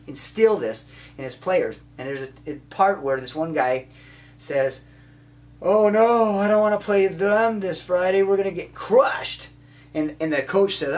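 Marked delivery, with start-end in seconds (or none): 5.6-9.5 high, loud mock dread; loud hammed-up "we're doomed" wail; whining player. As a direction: play up a defeatist player's complaint. Acting out a discouraged player.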